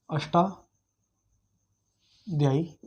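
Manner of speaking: Ashtadhyayi drawn out very slowly, broken by a long pause; slow dictation. Shown as a demonstration. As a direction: dictate Ashtadhyayi in two slow, separated parts. छात्रों को नाम दोहराकर लिखवाना. (अष्टाध्यायी को रुक-रुक कर, बहुत धीरे बोलकर लिखवाना)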